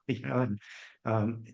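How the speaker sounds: normal talking voice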